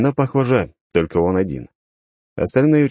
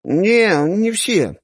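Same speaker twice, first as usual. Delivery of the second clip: stubborn; 3 dB louder, much slower than usual. Acted. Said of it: "упрямо помотал головой".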